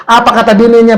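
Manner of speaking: ordinary talk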